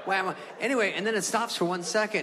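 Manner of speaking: ordinary delivery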